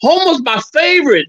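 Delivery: high, eager fake caller voice; a high put-on voice for a prank call. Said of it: "I told him".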